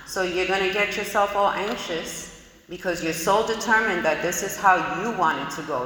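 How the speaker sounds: normal talking voice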